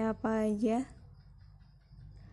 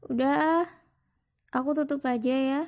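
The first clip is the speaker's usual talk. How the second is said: higher, louder hurried brush-off; 3 semitones higher, 6 dB louder than usual. Acted. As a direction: cut the talk short, a bit louder. Abruptly ending the call. (buru-buru menutup, lebih keras dan tinggi)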